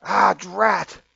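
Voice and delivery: cartoonish foiled-villain cry; startled robbers. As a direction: exclaim it as a thwarted bandit. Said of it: "and they go".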